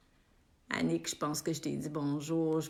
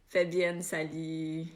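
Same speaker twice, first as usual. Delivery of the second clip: normal talking voice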